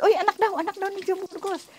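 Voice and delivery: high-pitched voices passing the word; imitated crowd buzz. Courtroom crowd passing word of whose child it is, imitated buzz. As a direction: mimic onlookers spreading news, voice lifted high.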